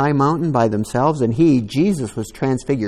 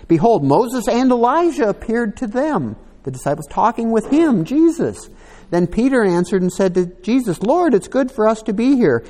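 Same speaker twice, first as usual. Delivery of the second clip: higher, louder, building reading; excited scripture reading; 7 semitones higher, 5 dB louder than usual. Acted. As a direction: read it like it's unfolding before you.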